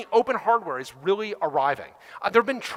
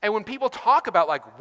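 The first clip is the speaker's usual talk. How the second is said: ordinary talk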